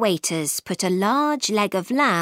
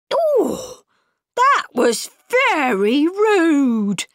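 slow, high-pitched, loudly offended talking pudding; indignant pudding; 7 semitones higher, 5 dB louder, much slower than usual. A narrator's reading. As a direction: cry out in drawn-out, high indignation. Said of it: "cried the pudding".

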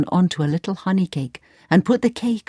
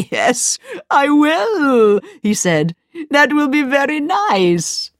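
slow, higher villain voice; wizard voice; 9 semitones higher, slower than usual. A narrator's reading. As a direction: savour every word, slowly.

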